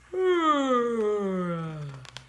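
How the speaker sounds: loud yawn with a snore in it; a big sleepy panda snore-yawn; snoring panda yawn. Shown as a demonstration. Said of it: "yawns a snoring yawn".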